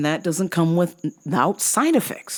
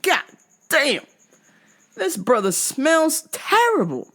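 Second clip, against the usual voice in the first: disgusted exclamation; 11 semitones higher, slower than usual. Retold or imitated. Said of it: "I was like".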